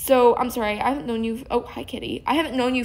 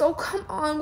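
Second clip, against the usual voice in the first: normal talking voice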